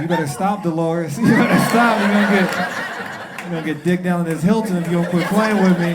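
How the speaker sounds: loud, high comic shouting; hammed-up, aroused protest at high volume; mock warning. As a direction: yell it as an over-the-top threat. Acted mock warning to the whisperer.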